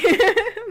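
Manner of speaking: sudden high, loud giggle; high burst of laughter. Real, not acted. Laughs at own hypocrisy.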